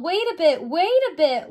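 called out. Acted. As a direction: call after a runaway to wait. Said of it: "they called".

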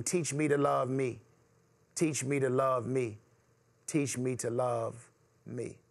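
low, slowing spoken-word plea; young man's prayer. Acted. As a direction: close the poem as a humble, spent prayer. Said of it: "looking up to the heavens".

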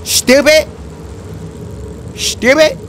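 ordinary talk, nothing marked

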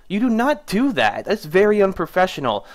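outraged rebuke on do not; indignant scold. Retold or imitated. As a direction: tell him off hard. Scolding the gloating tech.

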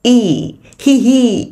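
E held long, then playful HE, HE; smiling ee. Shown as a demonstration. Teacher jokes that the mouth laughs, smiling long E. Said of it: "miệng cười á".

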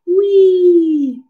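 high, loud slide-ride squeal; playful whee. Pretend. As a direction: let out a long gleeful "whee". Acting out going down the slide.

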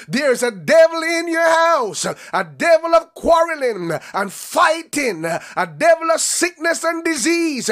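loud shouted preaching, driving each line; full-force preaching. Acted. Preacher shouting to listeners about the devil in their house. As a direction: hammer the congregation line after line.

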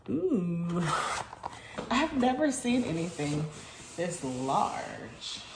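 normal talking voice

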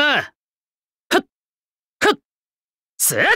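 short high grunts of effort on each strike; sharp battle kiai. Acted. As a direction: bark out quick sword-strike cries. Battle grunts during sword attacks.

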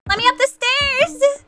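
high, stretched-out cry; panicked plea. Real, not acted. Player trying to flee up the stairs, high panicked plea. Fleeing the robot in game.

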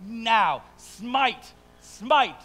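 high, loud attack-dog orders; barked command. Acted. Mock owner ordering an attack dog, commanding. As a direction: fire off each word as a forceful command.

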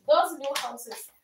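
ordinary talk, nothing marked

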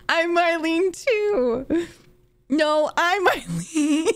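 high, slow, words squeezed through helpless laughter; crying with laughter while reading; laughing. Real, not acted. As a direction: barely get the line out for laughing. Reading texts, cracking up.

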